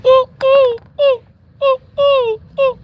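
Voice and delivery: high, loud imitated owl hooting; owl hoots. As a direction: hoot to call the owl. Calling the owl friend.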